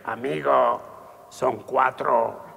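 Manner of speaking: low, very slow answer. Acted. The other drinker answering the sum, low and very slow. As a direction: drag it out low and dumb.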